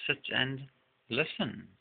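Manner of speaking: normal talking voice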